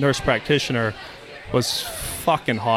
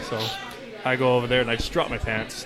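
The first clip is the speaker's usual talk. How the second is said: ordinary talk, nothing marked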